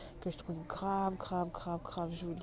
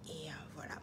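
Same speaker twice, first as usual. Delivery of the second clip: whispered aside; 3 semitones lower, 11 dB quieter, faster than usual. Real, not acted. Aside muttered after intro.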